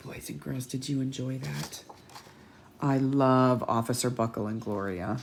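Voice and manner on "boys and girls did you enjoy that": tender, quiet teacher; gentle teacher voice. Real, not acted. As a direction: warmly check in with the class. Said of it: "talking softly to children".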